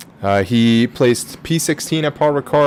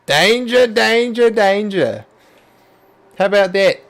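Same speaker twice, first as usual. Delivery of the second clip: mock Steve Irwin; 6 semitones higher than usual. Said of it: "Steve Irwin?".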